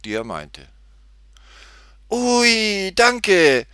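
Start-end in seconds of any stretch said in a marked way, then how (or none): 2.1-3.7 put-on high, loud girlish exclamation; delighted call. A narrator's reading. Tutti thanks the men for the tip, delighted high call. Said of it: "rief Tutti".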